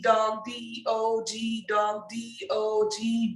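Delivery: ordinary talk, nothing marked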